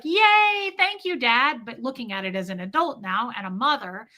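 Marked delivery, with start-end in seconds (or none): 0.0-1.6 put-on much higher and louder, gleeful child; excited little girl. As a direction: squeal it like a delighted kid thanking dad. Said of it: "I was just like".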